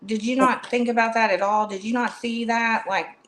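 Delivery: ordinary delivery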